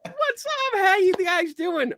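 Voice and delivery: squealed high, drawn-out hello; excited greeting. Real, not acted. Greeting guests she hasn't seen.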